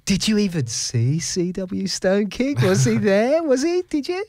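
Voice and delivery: disbelieving, rising to a squeak; a teasing disbelief, pitch soaring; incredulous. Retold or imitated. Mock incredulous questioning, rising.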